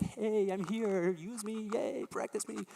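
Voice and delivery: high, drawn-out attention-seeking voice; eager guitar. Acted. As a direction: call out like a guitar begging to be played. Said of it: "the guitar is like".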